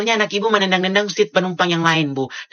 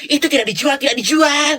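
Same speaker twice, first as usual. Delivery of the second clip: scolding mom; 7 semitones higher, 7 dB louder than usual. Acted. Mother scolds stubborn child.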